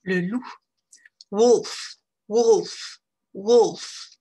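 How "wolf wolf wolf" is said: Wolf with a short relaxed oo; English short oo. Shown as a demonstration. Teacher drilling Wolf for French learners with a short oo. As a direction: keep Wolf loose and short. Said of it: "pour réussir à prononcer cette voyelle".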